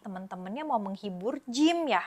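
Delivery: usual speaking voice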